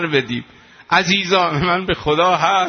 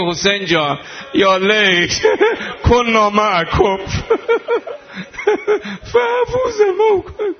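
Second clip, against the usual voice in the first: high, slow, sobbing cry; mourning chant broken by sobs; sobbing lament; 13 semitones higher, slower than usual. Real, not acted. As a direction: wail it out, weeping. Lamenting call in mourning rite.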